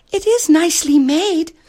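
very high, sweet princess coo. A narrator's reading. Princess admires the emperor's rose, cooing high. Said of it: "cooed the princess".

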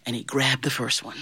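usual speaking voice

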